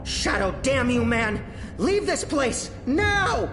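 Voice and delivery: frantic high yelling; shouting. Acted. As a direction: scream at him to flee. Calling out for escape.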